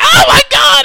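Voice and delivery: very high, loud imitation of panic; mock terrified scream. Little guy watching the stabbing, hammed-up terrified scream. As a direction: shriek it like a horrified witness.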